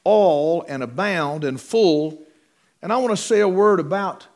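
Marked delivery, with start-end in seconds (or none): 0.0-2.2 "all", "abound", "full" each weighted; slow list hitting "all", "abound" and "full"; stress on three words. Shown as a demonstration. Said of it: "make emphasis of those three words".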